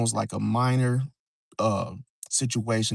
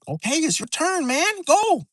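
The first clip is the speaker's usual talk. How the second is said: high-pitched urging, louder on the last word; impatient other player; 4 dB louder than usual. Acted. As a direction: rush them to move in a high voice. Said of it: "The other people are rushing you".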